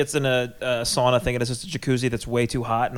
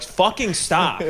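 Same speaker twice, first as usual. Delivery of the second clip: drawn-out, higher annoyed voice; slow mock complaint; 6 semitones higher, much slower than usual. Lifeguards tell the friend to stop barging in, slow annoyed. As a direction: drag out a fed-up plea. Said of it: "and they're like".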